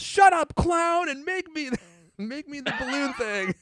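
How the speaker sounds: high, loud mock tantrum; upset kid. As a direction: imitate a bratty kid's demanding yell. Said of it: "you don't have to be so upset".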